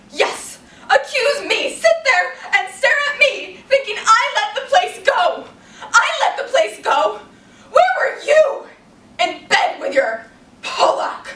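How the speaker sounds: high, loud theatrical tirade; acted hysterical outrage at a sister; furious accusation. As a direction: hurl the blame back at full voice. Blanche's accusing climax at her sister.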